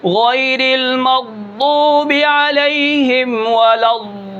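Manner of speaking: غیر المغضوب علیہم very slowly, ض of المغضوب from both sides of the tongue at once; slow chanted recitation, ض from both sides; both-sides dhad. Shown as a demonstration. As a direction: recite ولا الضال with both tongue edges pressed together. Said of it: "اگر دونوں طرف سے ہی نکالا جائے یکدم".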